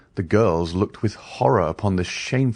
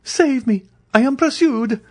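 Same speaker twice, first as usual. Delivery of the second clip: high frantic begging; desperate plea; 4 dB louder than usual. A narrator's reading. Andrea clasping his hands, begging in high panic. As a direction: beg to be saved. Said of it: "said Andrea, clasping his hands".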